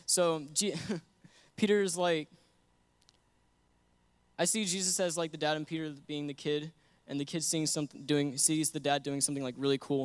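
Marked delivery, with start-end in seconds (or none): none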